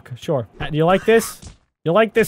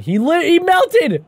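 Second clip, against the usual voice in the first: surprised; 9 semitones higher, 3 dB louder, slower than usual. Real, not acted. Buddy melts unexpectedly.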